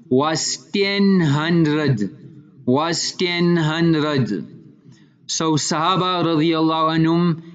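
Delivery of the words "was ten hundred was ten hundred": drawn-out repeat for weight; slow, emphatic. Retold or imitated. Sahabi admitting the highest number he could count to, slow and emphatic. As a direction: linger on "ten hundred" both times, deliberately.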